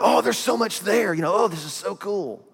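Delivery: high, excited gush over discovery; mock excitement. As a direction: squeal like a thrilled Bible reader. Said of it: "you're going".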